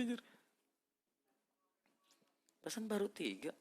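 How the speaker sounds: normal talking voice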